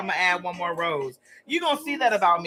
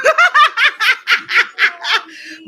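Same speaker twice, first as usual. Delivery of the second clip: big high burst of laughter; a long loud shrieking laugh; 13 semitones higher, 9 dB louder than usual. Real, not acted. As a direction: crack up loudly. Laughing at own thought.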